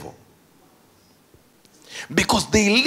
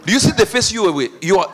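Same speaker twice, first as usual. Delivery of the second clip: normal talking voice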